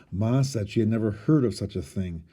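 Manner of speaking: plain reading voice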